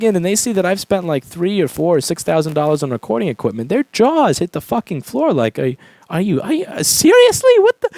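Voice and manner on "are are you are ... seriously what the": stammering, then shooting up high in pitch; mock disbelief. Store staff seeing the equipment bill, mock incredulous stammer. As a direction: imitate someone floored and lost for words. Imitating staff shocked at his spending.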